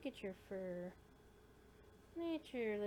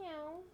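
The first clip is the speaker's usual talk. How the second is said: meow; 6 semitones higher than usual. Pretend. Meowing at the kitten.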